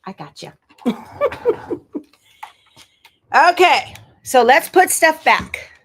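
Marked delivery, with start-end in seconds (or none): 0.8-2.0 breathy high laugh rising; light unvoiced chuckle; laughing at the wobbly camera; airy giggle. Real, not acted. Laughs after steadying camera. 3.3-5.7 louder, higher, slower rallying call; cheering on the cleanup, higher and louder; eager restart. Real, not acted. Rallying to start putting away.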